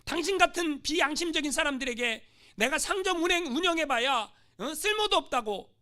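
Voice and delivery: loud indignant threat; missionary threatens the natives, loud and angry; threatening. Acted. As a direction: threaten to close the clinic. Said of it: "협박을 했어요".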